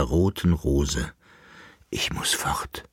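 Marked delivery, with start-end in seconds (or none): 1.9-2.8 unvoiced whisper, very soft. A narrator's reading. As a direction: breathe it out as a farewell. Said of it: "flüsterte sie". (flüsternd, sehr leise)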